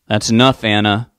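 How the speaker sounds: loud commanding call down the hall. A narrator's reading. Father calling out from the hallway, loud and commanding. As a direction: call it out to stop her. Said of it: "a man's voice called out".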